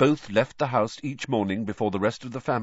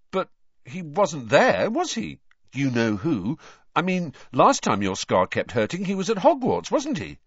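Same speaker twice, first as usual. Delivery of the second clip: dumbstruck Ron; 9 semitones higher, 5 dB louder than usual. Acted. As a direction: ask it in disbelief.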